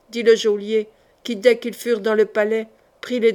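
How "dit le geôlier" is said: ordinary delivery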